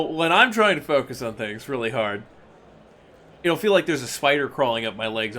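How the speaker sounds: ordinary talk, nothing marked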